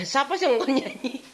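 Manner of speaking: laughing. Real, not acted. Laughing aside about singing.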